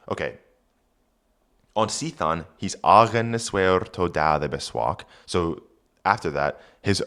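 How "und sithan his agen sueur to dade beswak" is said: put-on reading Old English aloud; careful Old English pronunciation. Shown as a demonstration. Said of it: "reads Old English text".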